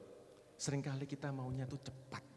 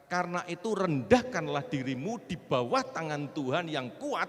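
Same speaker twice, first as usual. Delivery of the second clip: loud, raised preacher's proclamation; full-force preaching; 9 semitones higher, 10 dB louder, slower than usual. Real, not acted. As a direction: drive each promise home loudly. (khotbah lantang berapi-api)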